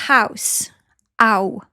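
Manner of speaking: HOUSE, then its gliding "ow" alone; diphthong picked out. Shown as a demonstration. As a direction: isolate the double vowel in HOUSE. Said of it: "Ow, c'est aussi une diphthong".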